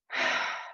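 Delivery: slow deep breath in and out. Shown as a demonstration. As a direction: breathe in deeply to calm. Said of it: "take a deep breath".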